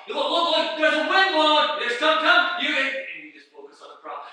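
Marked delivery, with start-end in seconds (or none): 0.1-2.9 high, loud taunting shouts; Satan's taunting. Acted. Satan distracting the walker, loud taunting. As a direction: yell it to break the walker's focus. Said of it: "And Satan says".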